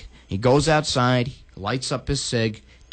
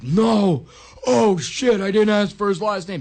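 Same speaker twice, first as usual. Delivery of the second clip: mock panicked realization; 7 semitones higher, 3 dB louder than usual. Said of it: "He's like".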